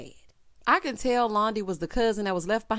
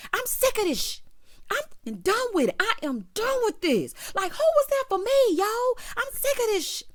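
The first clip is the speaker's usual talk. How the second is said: imitating an angry rant; 13 semitones higher, 3 dB louder than usual. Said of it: "we hear her in the back".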